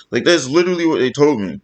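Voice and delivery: ordinary talk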